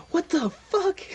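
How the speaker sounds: high, loud shocked squawk; a guard caught off guard, yelping high. Acted. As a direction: blurt it out, stunned. Guard startled by confession.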